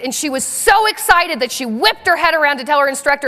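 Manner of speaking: ordinary delivery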